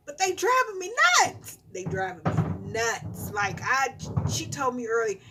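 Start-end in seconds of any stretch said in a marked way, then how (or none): 0.1-1.6 higher, louder complaint; much higher exasperated cry. Real, not acted. Exasperated about kids. 1.7-3.3 lower, slow, deadpan restatement; slow resigned deadpan; flat repeat. Real, not acted. Repeated flatly.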